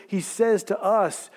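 ordinary delivery

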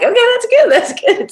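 very high, eager approval; high praising. Retold or imitated. Teachers praising a cussing girl for swapping words, high and bright. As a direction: cheer her on in a high voice.

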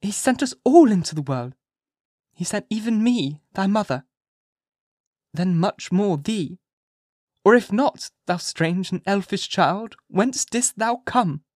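high, slow, drawn-out admonishing; distressed mother. A narrator's reading. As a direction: plead slowly with a strange child. Mother admonishing and questioning her child.

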